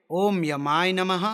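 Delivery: measured intoned name mantra; mantra recitation. Shown as a demonstration. Teacher reciting Yama's name mantra for learners, measured recitation. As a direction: intone it with held syllables. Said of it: "इन मंत्रों को हम बोलते हैं".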